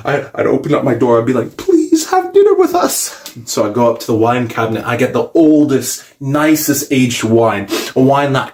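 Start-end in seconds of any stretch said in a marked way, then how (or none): 1.6-3.3 high, slow fanboy plea; hammed-up eager invitation; mock pleading. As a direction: beg in a squeaky, excited voice. Acting out begging Godzilla to dine.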